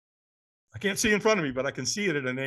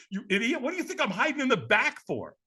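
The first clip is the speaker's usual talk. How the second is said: mock exasperation, pitched up; exasperated inflection; 7 semitones higher than usual. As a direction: retort with exaggerated rising inflection. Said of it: "with some voice inflection".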